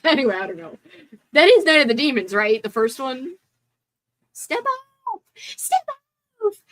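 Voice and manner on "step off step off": high, intense yell; movie character quote. Retold or imitated. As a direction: snap it like a rattled character. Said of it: "I like when he goes, like, really into it".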